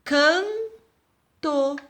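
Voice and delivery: canto as can, pause, to; split into syllables. Shown as a demonstration. Teacher counting two syllables, word split apart. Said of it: "duas sílabas".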